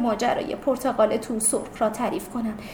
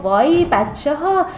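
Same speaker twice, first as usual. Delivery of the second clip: loud, urgent call to friends; loud exclamation; 5 semitones higher, 5 dB louder than usual. Acted. Girl correcting her classmates, exclaiming loudly. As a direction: raise your voice to set them straight. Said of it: "یکو شفیقه بلند گفت".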